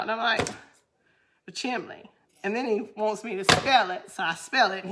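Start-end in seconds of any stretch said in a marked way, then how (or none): 1.4-2.1 soft "chimney" as CHIM-lee; quietly insist, with an L in "chimney"; dialect pronunciation. Retold or imitated. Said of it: "is what I would always say".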